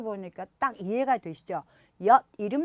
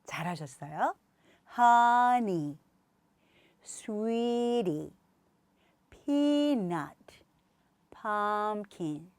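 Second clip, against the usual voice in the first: each word slowly, first syllable stretched; slow repeat-after-me drill; much slower than usual. Shown as a demonstration. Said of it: "천천히 해보실게요".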